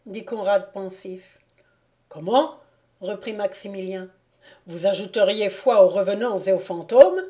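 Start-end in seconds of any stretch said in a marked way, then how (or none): none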